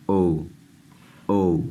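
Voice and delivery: drawn-out English o, as in go; long o sound. Shown as a demonstration. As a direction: say او as a clean pure long o. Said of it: "تو یہ او کی آواز دے گا".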